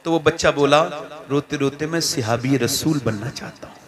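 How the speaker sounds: hushed, gentle sermon story voice; soft storytelling. A narrator's reading. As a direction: draw them in softly. (धीमी आवाज़ में कहानी सुनाना)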